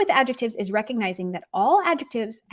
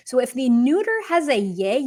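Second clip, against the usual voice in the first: ordinary delivery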